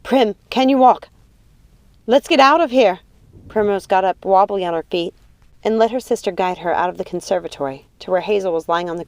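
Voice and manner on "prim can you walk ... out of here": raised, pressing voice; loud, higher, hurried concern; urgent concern. A narrator's reading. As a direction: rouse your hurt sister urgently. Urges hurt sister to escape.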